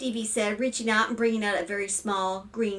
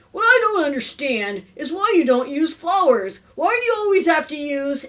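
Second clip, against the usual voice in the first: louder, higher complaint; exasperated father; 7 semitones higher, 7 dB louder than usual. A narrator's reading. Father questions wife about flower arrangements, exasperated. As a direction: press the point in exasperation.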